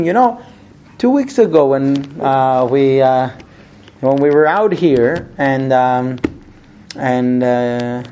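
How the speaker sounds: low, slow, halting lead-in with drawn-out fillers; feeling the way into a complaint; hesitant opening. Acted. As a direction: broach a touchy subject reluctantly. Wife hesitantly raising old grievance.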